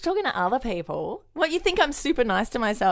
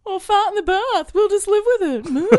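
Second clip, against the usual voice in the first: high silly voice; 11 semitones higher, 4 dB louder than usual. Acted. High put-on voice.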